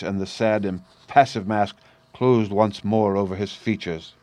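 ordinary delivery

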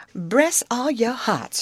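slow, high Southern dialect exclamation; effusive storybook Southern welcome; exclaiming. A narrator's reading. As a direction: draw it out in delighted welcome. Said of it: "she exclaimed".

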